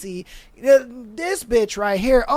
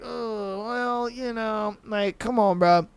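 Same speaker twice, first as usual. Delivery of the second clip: muttered mock disappointment; 6 dB quieter, slower than usual. Mocking Casey disappointed.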